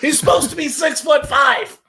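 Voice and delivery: high, loud indignant yell; joining the joke about the author, high and loud; mock outraged author. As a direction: yell it like a scandalised novelist. Joins the mock author rant.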